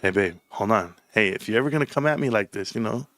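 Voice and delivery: low, serious talk to babe; mock serious. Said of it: "then it's gonna be serious".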